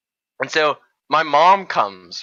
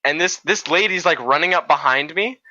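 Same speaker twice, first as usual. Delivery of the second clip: ordinary talk, nothing marked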